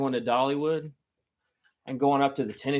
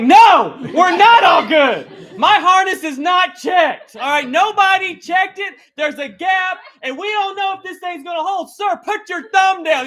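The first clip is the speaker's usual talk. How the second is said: much higher and louder, slow frantic shouting; panicked yell; 11 dB louder than usual. Retold or imitated. Scared boy shouting at the operator to check his harness, frantic yell. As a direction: yell for help, pleading and panicked.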